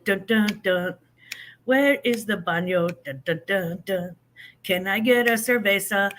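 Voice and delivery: ordinary talk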